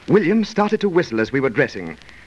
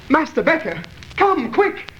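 high, urgent cry to the manager; panicked call for help; 9 semitones higher than usual. Acted. Edward calls Master Becker to the stabbed Alan, high and panicked. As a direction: shout for help in alarm.

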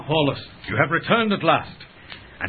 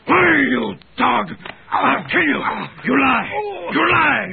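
furious shout; 10 semitones higher than usual. Acted. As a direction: roar it in disbelief and rage. Husband hears of wife's betrayal.

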